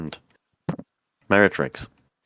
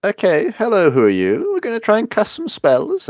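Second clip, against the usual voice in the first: villain greeting; 12 semitones higher than usual. Acted.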